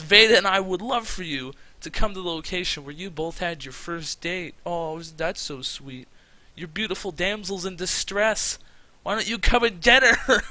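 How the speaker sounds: high, mocking villain taunt; Kafu. Kidnapper luring the boyfriend to a rescue, taunting. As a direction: build from mock-sweet to a loud gloating dare. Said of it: "what a fucking psycho".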